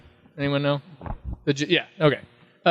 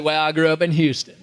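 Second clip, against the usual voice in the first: put-on picked-up Texan accent. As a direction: echo the other man's Texan twang. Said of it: "he's gonna think I'm doing his accent".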